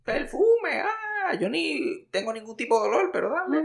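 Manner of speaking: high, bright playful gushing; mock excited voice. Joking with a friend about gifts, gushing mock delight. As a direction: gush over the perfume like a kid. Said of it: "y dije".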